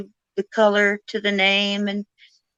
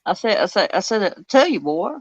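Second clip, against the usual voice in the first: Foghorn Leghorn; 3 semitones lower, 3 dB quieter than usual. Retold or imitated. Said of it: "Benjamin Franklin's Foghorn Leghorn".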